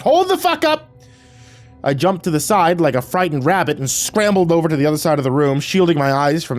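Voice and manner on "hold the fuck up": very high, startled shriek; high-pitched cry of alarm; panicked shriek. A narrator's reading. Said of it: "I shrieked".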